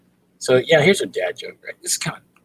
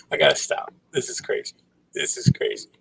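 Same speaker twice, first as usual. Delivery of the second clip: ordinary talk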